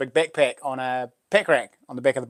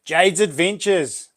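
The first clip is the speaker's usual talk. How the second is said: excited announcer; 5 semitones higher, much slower than usual. Real, not acted. Announcing a viewer's name.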